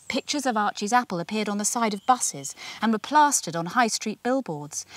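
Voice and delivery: usual speaking voice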